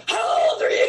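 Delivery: high, loud, laughing yell of disbelief; mock exasperated shout. Costar yelling at the young prankster, high loud laughing shout. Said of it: "And he's like".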